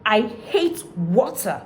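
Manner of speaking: slow, emphatic swoop through "I hate water"; drawn-out demo, pitch rising and falling; expressive up-and-down intonation. Shown as a demonstration. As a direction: say "I hate water" with strong feeling. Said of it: "your voice goes up and down".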